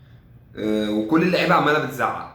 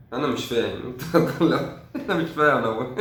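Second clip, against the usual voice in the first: laughing in confusion. Real, not acted. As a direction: break into a real laugh. Laughs at his own confusion.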